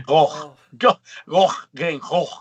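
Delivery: "Gogh" repeated with a throaty Dutch rasp; guttural Gogh. Shown as a demonstration. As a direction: grind each "Gogh" out from the throat. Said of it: "you know, get guttural".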